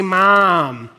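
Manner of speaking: slow Midwestern "mom", the vowel drawn out. Shown as a demonstration. Preacher on words his kids mock, Midwestern accent. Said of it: "because I'm from the Midwest".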